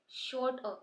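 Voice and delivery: usual speaking voice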